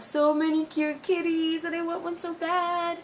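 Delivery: high, squealy cooing over kittens; gushing longing. Real, not acted. Longing for a kitten at the pound, gushing squeal. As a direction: climb into a pleading little whine.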